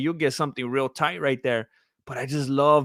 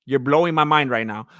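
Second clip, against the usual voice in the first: ordinary talk, nothing marked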